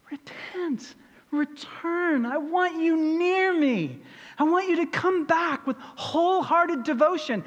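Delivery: voicing God, high and earnest; God pleading. Preacher voicing God urging the people to return. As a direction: plead like God calling them home.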